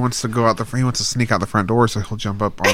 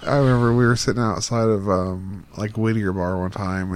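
ordinary talk

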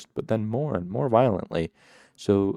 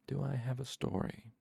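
put-on much softer, spoken to a baby; very quiet; 4 semitones higher, 10 dB quieter than usual. A narrator's reading. Trappist asking the baby, speaking very quietly. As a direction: murmur it to the baby. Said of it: "He spoke very quietly".

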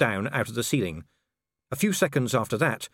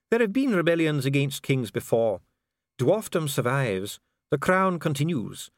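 solemn official Dee; 5 semitones higher than usual. Acted. Official defends dwarf tradition to visitor, solemn. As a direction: recite each certainty like doctrine.

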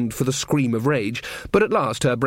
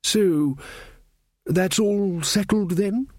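higher, slow, hopeful rising "settled"; Verence's voice; 7 semitones higher, slower than usual. Acted. King Verence asking Magrat if it's settled, slow and higher. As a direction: draw out "settled" upward like a pleased king.

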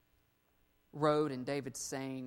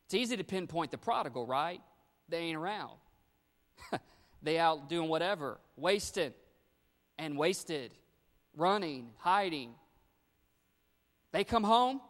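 louder, higher preaching surge; preaching at full force; 4 semitones higher, 6 dB louder than usual. Real, not acted. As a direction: build the energy toward the homecoming. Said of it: "preaching builds to a climax".

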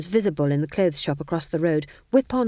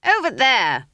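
louder, sharp pointing out; insistent old witch; 6 semitones higher, 6 dB louder than usual. A narrator's reading. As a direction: insist loudly, jabbing the direction. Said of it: "she said".

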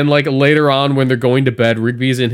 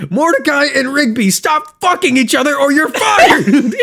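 much higher, slow, laughing mock boss yell; angry boss Benson; 3 dB louder than usual. Boss Benson catches Mordecai and Rigby, mock angry yell. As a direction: fire them both, half laughing. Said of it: "Benson walks into them and he goes".

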